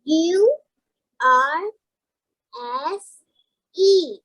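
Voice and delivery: letters spelled out slowly, one at a time; spelling slowly. Real, not acted. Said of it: "that's C".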